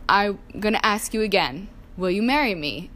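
normal talking voice

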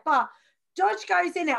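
ordinary delivery

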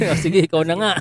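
ordinary talk, nothing marked

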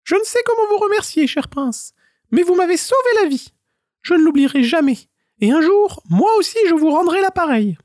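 very high, squeaky little squirrel voice pleading. A narrator's reading. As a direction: beg like a tiny trapped squirrel. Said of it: "Celui-ci bondit prestement au sol et affirma". (petite voix aiguë d'écureuil suppliant)

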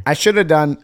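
normal talking voice

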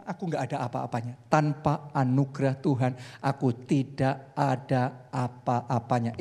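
bow down in your voice; humble. Retold or imitated. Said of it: "tapi dia ngomong".

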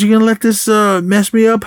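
high-pitched imitated grievance; mock indignation. Chainsaw protests after the crashed car, mock indignant. Exasperated complaint in retold movie scene.

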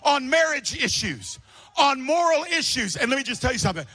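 loud, high, driving sermon voice; fiery pulpit preaching; full-force preaching. Acted. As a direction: preach it hard to the room.

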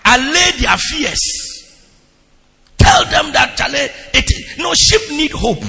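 loud, high, fervent sermon delivery; preaching at full force. Acted. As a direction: preach it out to the congregation, building to the psalm.

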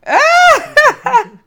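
high burst of laughter; loud laugh. Real, not acted. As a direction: let out a big laugh. Big laugh after Merry Christmas.

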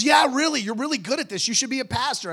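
high, loud retold encouragement; earnest insistence. Girl urging pastor to become one, enthusiastic. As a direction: gush it, lifting the pitch.

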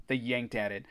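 plain reading voice

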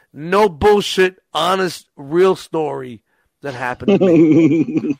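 slow, loud, each word stressed; emphatic. Real, not acted. Swearing to friends the story is true, slow emphatic. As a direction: hammer each word home. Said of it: "this is fucking the truth".

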